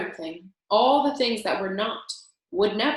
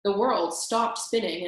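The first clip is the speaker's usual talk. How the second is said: usual speaking voice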